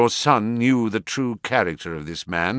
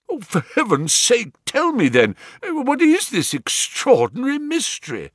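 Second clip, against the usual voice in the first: high, pressing plea; pleading impatience; 13 semitones higher than usual. Acted. Banker begging Holmes to explain, high and impatient. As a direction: beg for the answer.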